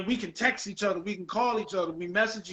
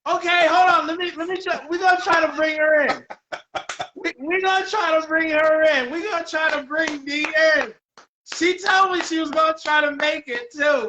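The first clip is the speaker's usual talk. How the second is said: high, loud, excited rush of welcome; real excitement; 6 semitones higher, 4 dB louder than usual. Real, not acted. Host bringing the guest in live, excited. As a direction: call it out high and thrilled.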